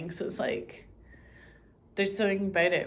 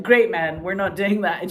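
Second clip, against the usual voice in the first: loud, flat refusal; a loud no thanks; mock refusal; 8 dB louder than usual. Turning down the offer, a loud mock refusal.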